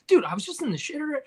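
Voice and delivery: high, fast, mock-outraged bro voice; hammed-up indignant storyteller, pitched high and quick; guy on the street. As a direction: rant about the bathroom like a wronged buddy. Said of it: "like, telling this story to another person".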